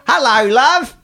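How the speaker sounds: high, loud attempt at Australian accent. Shown as a demonstration. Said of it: "what would Australian be like".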